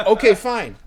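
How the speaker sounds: high, loud mock surrender; retold fed-up concession, punched out; exasperated retort. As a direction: give in loudly and crossly.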